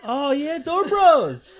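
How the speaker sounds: high, laughing celebration; excited cheer. Real, not acted. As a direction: whoop it out with your buddy. Players celebrate sharing a spell.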